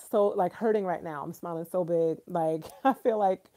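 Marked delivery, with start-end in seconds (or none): none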